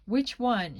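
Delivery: loud defiant retort. A narrator's reading. Cornered girl answers the demand for the wallet, defiant. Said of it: "sounding defiant".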